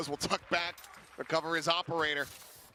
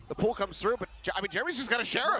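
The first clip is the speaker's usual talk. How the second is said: esports caster; 8 semitones higher, 4 dB louder, faster than usual. Real, not acted. Hyped play-by-play call.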